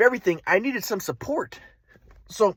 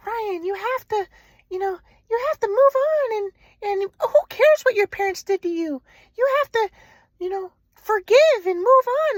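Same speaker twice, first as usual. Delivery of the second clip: put-on girlfriend's voice; 12 semitones higher than usual. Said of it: "And she's like".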